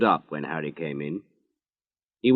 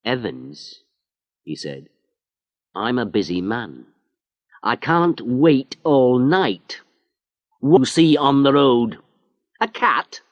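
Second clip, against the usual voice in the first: impatient sarcasm; 5 semitones higher, 5 dB louder than usual. Acted. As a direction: sneer at his excuse. Inspector mocks Harry's story.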